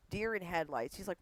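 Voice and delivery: usual speaking voice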